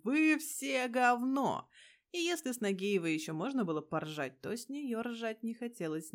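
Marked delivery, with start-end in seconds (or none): 0.0-1.7 slow, loud parade-ground yell; slow drill-sergeant shout at the troops. Acted. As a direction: bark it at the whole formation. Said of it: "хождением с криком".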